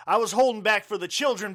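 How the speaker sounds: ordinary talk, nothing marked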